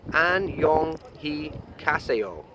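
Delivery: "Annyeong hikaseo" dragged syllable by syllable; very slow Korean goodbye. Shown as a demonstration. Vlogger slowly spelling out goodbye for viewers. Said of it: "You say".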